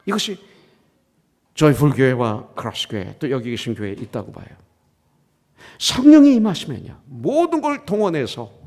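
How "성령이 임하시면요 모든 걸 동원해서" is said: loud, high, slowing preacher's cry; preaching burst. Real, not acted. Pastor proclaiming to his church, loud and high. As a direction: proclaim it to the congregation, rising hard. Preacher's climax on the Holy Spirit.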